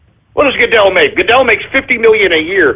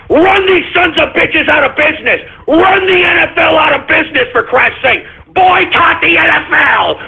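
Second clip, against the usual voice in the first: raised, pitched-up tirade at full steam; heated ranting shout; 8 semitones higher than usual. Real, not acted. Host rails at listeners to boycott the league, shouting.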